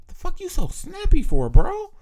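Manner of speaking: high, loud indignant retort; mock snappy comeback. Telling his girl off about her snappiness, high and loud. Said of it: "And I'm like".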